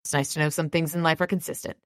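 usual speaking voice

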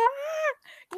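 sudden high exasperated "Ah!"; high exasperated yelp; frustrated high cry. Retold or imitated. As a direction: cry out, fed up with the sticky heat. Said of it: "eu falei".

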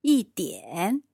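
crisp native 一点, each tone clear; model pronunciation again. Shown as a demonstration. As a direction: repeat 一点 slowly for learners to copy. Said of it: "Let's hear that word again".

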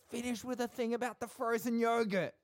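high-pitched imitation of someone yelling instructions; mock off-mic yelling. Host mimicking Cooper yelling from off mic, high mock yell. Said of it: "Cooper off mic yelling at me".

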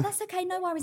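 imitating own polite phone manner; breezy phone voice.

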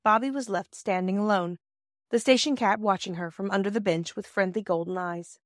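ordinary delivery, nothing marked

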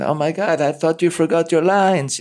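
high, mock-alarmed bad Israeli accent; lightly hammed-up Israeli accent, rising in shock; [name]'s bad Israeli accent. Actor quoting the script supervisor's surprise in a bad Israeli accent. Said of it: "I was my bad Israeli accent".

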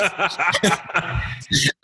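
high, bubbling laugh; amused laughter. Real, not acted. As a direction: burst out laughing at your own confession. Laughing at own ordering trouble.

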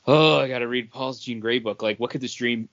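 usual speaking voice

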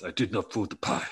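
a light mock Italian accent on "I did not throw the pie". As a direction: deny it in a hammed-up Italian accent. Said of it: "'cause he's Italian now".

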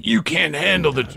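lightly acted courtroom-drama line; mock movie courtroom outburst. Host quoting a courtroom film to former debate student, mock outburst. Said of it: "Which part did you play".

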